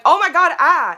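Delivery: high, loud startled cry; shocked gasp. Retold or imitated. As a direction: yelp in alarm. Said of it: "And I went".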